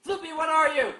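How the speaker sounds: loud playful call. Pretend. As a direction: shout the question at the drawing. Calling out to the character.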